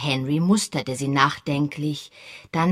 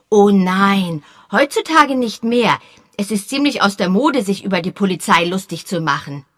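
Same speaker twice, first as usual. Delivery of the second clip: put-on high, louder earnest boy's voice; a serious young boy explaining; 4 semitones higher, 7 dB louder than usual. A narrator's reading. Boy Peter earnestly correcting Sir Henry, high boyish voice. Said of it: "erklärte Peter ernsthaft".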